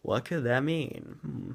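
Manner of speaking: soft, lilting mock puzzlement; exaggerated wondering. Reviewer acting out viewers wondering at a film mystery, hammed-up puzzlement. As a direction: ponder aloud with theatrical hums. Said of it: "you're wondering".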